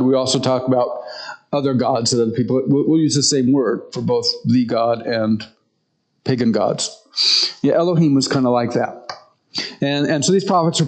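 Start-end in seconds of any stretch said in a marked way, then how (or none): none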